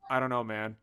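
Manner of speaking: low, soft, noncommittal reply; acted indifferent shopkeeper; unbothered shrug. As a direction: shrug it off quietly. Unbothered store owner answers nervous guest.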